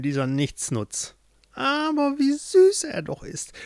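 put-on cackle-toned old witch sneering at her captive. A narrator's reading. Witch speaks to caged Jorinde. (hohe, hämische Hexenstimme)

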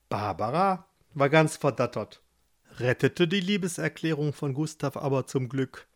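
ordinary delivery, nothing marked

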